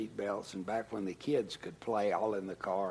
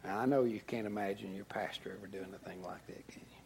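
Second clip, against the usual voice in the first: soft, quick confiding whisper; whispered aside; 2 semitones lower, 6 dB quieter, faster than usual. Real, not acted. Pastor whispering a confession to the congregation. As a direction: let the congregation in on a secret. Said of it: "whispered aside to congregation".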